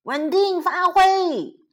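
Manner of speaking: high, loud storybook bellow; loud roar. A narrator's reading. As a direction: roar it out to the racers. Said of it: "伯尼大声吼着说：".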